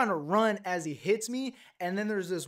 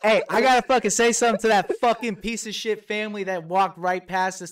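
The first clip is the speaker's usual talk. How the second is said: loud, heated hey hey hey then a rant; angry call-out; 2 semitones higher, 8 dB louder than usual. Real, not acted. Furious friend venting about the family that ignored them, loud anger. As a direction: vent at the family who walked past.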